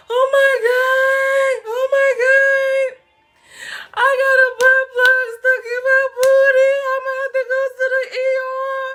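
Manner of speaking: high, loud half-crying, half-laughing panic; panicked wail. Retold or imitated. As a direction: wail it like you're about to lose it. Reliving panic over stuck plug.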